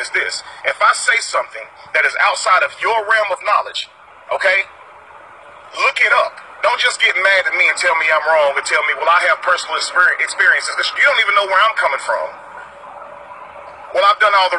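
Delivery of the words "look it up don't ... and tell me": usual speaking voice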